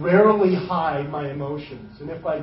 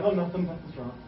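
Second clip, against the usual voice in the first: low, soft, quick dismissive mumble; we brush off the king, low and hushed; 5 semitones lower, 11 dB quieter, faster than usual. Retold or imitated. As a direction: wave the king away under your breath. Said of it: "We'd be like".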